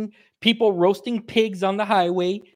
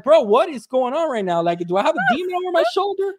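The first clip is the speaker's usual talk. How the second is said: incredulous; 9 semitones higher than usual. Retold or imitated. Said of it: "I'm like".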